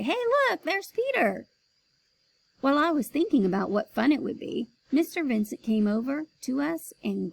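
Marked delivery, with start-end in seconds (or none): 0.0-1.5 much higher excited voice; excited friends. Retold or imitated. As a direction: friends spotting him on TV. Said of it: "friends would ... say".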